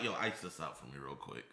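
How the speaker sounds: a hint of a flashy rapper ordering; mild mock swagger. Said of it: "he was like".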